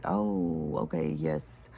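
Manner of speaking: low, level acknowledgement; acted compliance. Answering the little inner voice, acting compliant. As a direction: drop way down into an obedient "okay".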